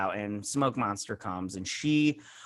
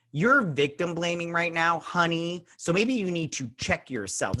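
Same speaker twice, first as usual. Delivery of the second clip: higher, sassy clapback with a finger snap; sassy put-down; 6 semitones higher than usual. Pretend. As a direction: tell her off with attitude, honey. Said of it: "Z snap right there".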